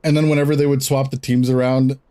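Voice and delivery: ordinary delivery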